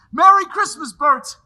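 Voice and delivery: loud, high, beaming holiday greeting; joyful shout. Acted. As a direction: shout it with pure joy. Said plain then shouted.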